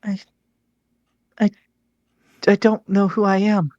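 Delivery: high, lost ghost voice. Acted. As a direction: speak like a confused spirit.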